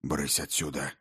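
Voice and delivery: low, soft and muffled. Acted. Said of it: "глухо бросил".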